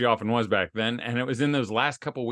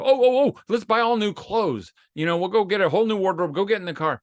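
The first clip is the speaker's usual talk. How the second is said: high, loud, gushing over-eager offer, then rushing on; mock overexcited husband; 7 semitones higher, 4 dB louder than usual. Husband mocking his own over-eager fix, hammed-up excitement. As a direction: ham up a frantic husband rescuing her with shopping.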